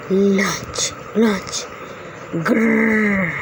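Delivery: soft menacing wolf; hungry wolf. Acted. Wolf spotting lunch.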